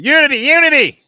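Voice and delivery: high, loud nasal cheer; nasally teammate impression. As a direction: mimic a very nasally huddle chant. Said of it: "Junior has a very nasally voice".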